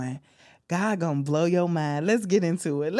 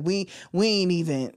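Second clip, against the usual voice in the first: normal talking voice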